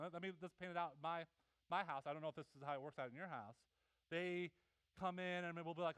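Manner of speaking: ordinary talk, nothing marked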